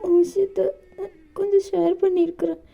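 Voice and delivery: high, pleading voice with crying breaks; tearful young lover. Acted. JK pleads with Dev not to leave, high and tearful. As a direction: beg him not to leave you, close to sobbing.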